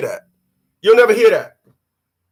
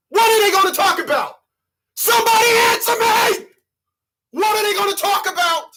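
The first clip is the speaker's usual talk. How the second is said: high yelling; 10 semitones higher than usual. Acted. Comedian demanding the crowd answer, high yelling.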